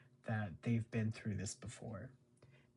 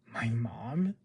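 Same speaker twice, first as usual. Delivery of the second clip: mock shocked; 7 dB louder than usual. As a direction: overplay a surprised gasp of a question. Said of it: "some of Peyton List's acting choices that I think are kinda just funny".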